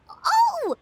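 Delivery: high, loud sudden "oh"; sudden realization. Acted. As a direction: it clicks all at once. Suddenly realizes diary numbers.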